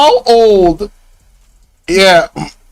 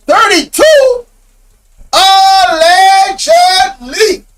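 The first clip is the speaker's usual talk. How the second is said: stunned disbelief; 8 semitones higher than usual. Real, not acted. Stunned at husband's age.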